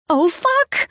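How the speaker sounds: sudden high panicked shriek. Acted. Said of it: "I shrieked".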